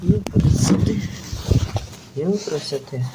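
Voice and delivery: normal talking voice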